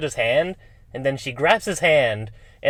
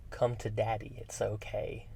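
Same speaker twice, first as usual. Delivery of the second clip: much softer, hushed creepy lure; creepy Uncle Frank; 12 dB quieter than usual. Retold or imitated. Podcaster quoting Frank luring Kirsty, soft creepy coaxing. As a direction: coax her in, quiet and sinister. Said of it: "says, and I quote".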